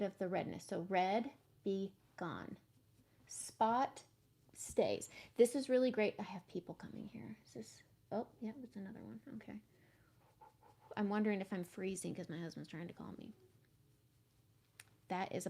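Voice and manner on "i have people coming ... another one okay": real whisper, much softer; hushed whisper. Real, not acted. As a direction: whisper so the people coming in don't hear. Whispering as people arrive nearby.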